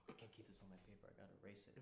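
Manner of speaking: very soft and low, to oneself; hushed inner thought. Retold or imitated. Boy alone with his confession on paper, hushed. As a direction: say it under your voice, as a secret. Acted private panicked thought in class.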